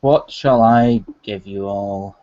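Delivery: usual speaking voice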